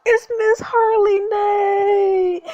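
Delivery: high, loud, drawn-out squeal of delight; excited cry. Real, not acted. Player spotting a favourite character, excited cry. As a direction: stretch it out loud and high, overjoyed.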